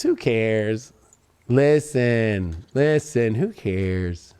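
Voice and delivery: lightly voiced mom, low and soft; imitating mom. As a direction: drop low and quiet, dismissive like mom. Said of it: "As my mom always says".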